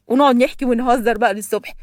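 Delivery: ordinary delivery, nothing marked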